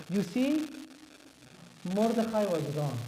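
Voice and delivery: put-on Persian accent, unhurried; lightly hammed-up Persian accent. Shown as a demonstration. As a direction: say it like a Persian speaker. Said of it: "I could actually do the Persian accent".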